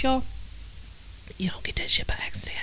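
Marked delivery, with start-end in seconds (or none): 1.4-2.6 quick hushed whisper; knowing whisper. Acted. Host whispering a quick aside about Bruce Jenner.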